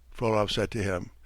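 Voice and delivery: usual speaking voice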